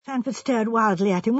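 ordinary delivery

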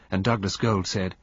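usual speaking voice

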